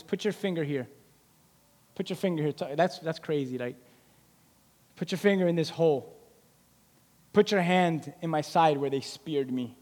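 soft, intimate offer; Jesus inviting Thomas to touch the wounds, soft; gentle invitation. Retold or imitated. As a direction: draw him close quietly.

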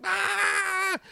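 high squealing scream, put on; mock panic scream. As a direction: imitate a panicked kid's shriek. Brother panics over lost hamster.

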